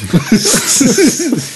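high helpless laugh; high laughter. Real, not acted. Game master laughing high after the scene. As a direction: crack up.

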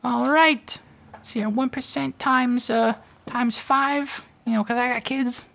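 very high, squeaky hustler voice. Acted. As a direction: play a slick, grinning money changer. Money changer haggles for five percent.

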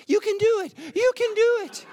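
very high, squealed encouragement; cheerleading sevens. Retold or imitated. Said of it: "Where are the sevens?".

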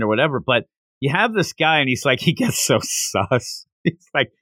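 usual speaking voice

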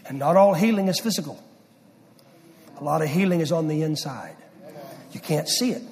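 climbing, loud, high, full pulpit force; preaching loud. Real, not acted. Preacher to congregation, loud, high and forceful. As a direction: drive the point home to the congregation.

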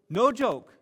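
mock-serious emphasis. Real, not acted. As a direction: push it harder the second time. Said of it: "emphatic repeat".

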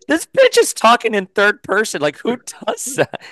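high-pitched mock disbelief; high, comically baffled; incredulous reaction. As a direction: react as if you cannot believe anyone talks like that. Acts out incredulous reaction to Storm.

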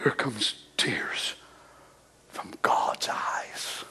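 slow, hushed whisper of tears falling. Acted. Preacher picturing God's tears for the congregation, slow whisper. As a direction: whisper it like a secret grief.

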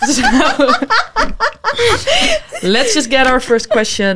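high giggling through the words; laughing. Real, not acted. Host laughing at a misheard word, can't stop giggling. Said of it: "Is that why you started laughing?".

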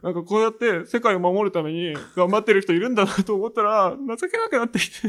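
high, tremulous teary confession, lightly voiced; mock the girl's shaky, tearful voice; teary cabaret girl. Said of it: "声震えてて". (高い声で震えながら泣きそうに話すキャバ嬢の真似)

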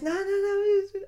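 very high, squeaky panicked dealer; mock panic in a squeak; flustered salesman.

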